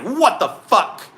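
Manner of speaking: mock outrage at a driver swerving; retold road rage. Cut off by a truck, hammed-up slow outraged yell. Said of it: "I'm like".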